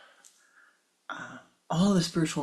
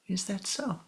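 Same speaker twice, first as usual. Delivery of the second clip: soft, unbothered reply; calm acceptance; 5 semitones higher, 5 dB quieter than usual. Acted. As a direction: let it go gently.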